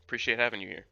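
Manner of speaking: normal talking voice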